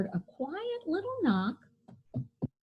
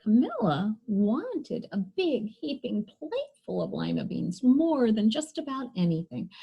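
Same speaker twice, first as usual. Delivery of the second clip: usual speaking voice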